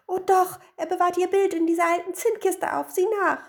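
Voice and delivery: high, loud wailing protest; high-pitched upset wife; distraught insistence. A narrator's reading. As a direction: insist through hammed-up distress. Weeping wife insists to her mother.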